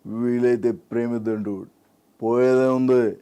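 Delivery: drawled film-hero line, swelling at the end; Prabhas. Acted. Host asks for Prabhas, laid-back dialogue impression. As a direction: keep it level, then stretch the last phrase. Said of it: "Prabhas".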